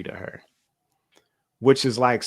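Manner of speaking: ordinary delivery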